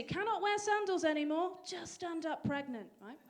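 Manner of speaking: ordinary talk, nothing marked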